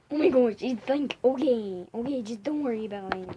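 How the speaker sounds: loud, exclaiming put-on voice. Brushing off the friend's worry, loud put-on voice. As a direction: exclaim loudly in an assumed voice, then ease off. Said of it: "why are you talking like this".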